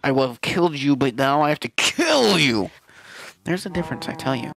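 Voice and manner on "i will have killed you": usual speaking voice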